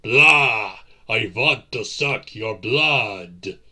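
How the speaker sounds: loud, slow hammed-up vampire cry; mock vampire shout. Vampire mocking how others imagine him, hammed-up shout. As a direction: ham up the bloodsucker cliché, drawn out. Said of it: "something as crass as shouting".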